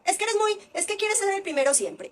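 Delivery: mock scolding mother; scolding parent. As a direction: imitate a parent labelling the kid. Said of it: "no taches".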